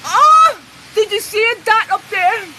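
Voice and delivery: high, loud alarmed yell; real startled yell at a flash; startled shout. Real, not acted. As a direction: jolt out the words in alarm.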